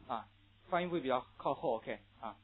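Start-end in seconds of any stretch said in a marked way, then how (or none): none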